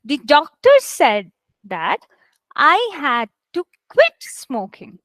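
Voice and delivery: slow read, stressing "doctor" and "quit"; measured teacher's model with "quit" punched; stressed key words. Shown as a demonstration. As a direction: read it out slowly in chunks, leaning on "doctor", "said" and "quit". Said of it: "tell me what, where the stress should be".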